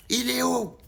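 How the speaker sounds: loud, high yelled demand; angry officer calls out again; shouted question. Retold or imitated.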